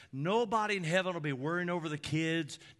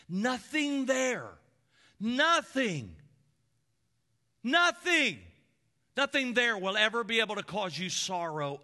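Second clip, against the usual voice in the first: rising, louder preaching with pounding repeats; full-force preaching; 6 semitones higher, 4 dB louder than usual. Acted. Preacher stirring the congregation about heaven, full-force preaching. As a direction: build each nothing to a climax.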